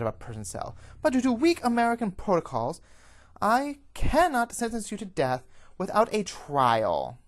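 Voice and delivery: loud, high formal condemnation; stern tirade. Acted. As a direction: pronounce judgement on the prisoner forcefully. Official berates the captured prisoner.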